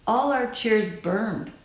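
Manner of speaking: usual speaking voice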